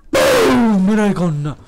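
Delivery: a loud, high "Boom"; gloating shout. Real, not acted. Gamer shouting at the player he just beat, loud gloating. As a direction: slam it down like a victory blast.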